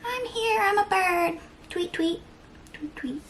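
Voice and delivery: high chirpy little bird voice. Pretend. Pretending to be a happy bird, high and chirpy. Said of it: "It's like they're".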